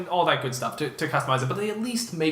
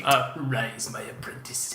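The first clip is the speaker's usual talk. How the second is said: cold rasping evil emperor; slower than usual. Pretend. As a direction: whisper it like a dark lord. Star Wars emperor quote.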